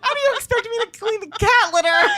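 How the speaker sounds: high, crying voice with laughter; tearful laughing plea. Retold or imitated. Retelling her tearful plea to Ashley, crying voice. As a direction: sob the question out. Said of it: "I was like".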